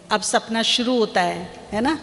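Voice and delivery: ordinary talk, nothing marked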